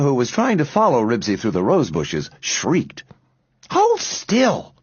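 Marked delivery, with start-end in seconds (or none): 3.7-4.7 high-pitched bossy command; firm order. A narrator's reading. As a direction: order a squirming little sister about. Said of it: "directed Beezus".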